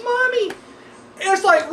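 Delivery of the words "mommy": put-on high, small child calling mommy; mock creepy kid voice; sweet little kid voice. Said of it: "you hear this sweet little voice".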